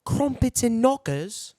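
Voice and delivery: hammed-up British accent, slowly; mock British.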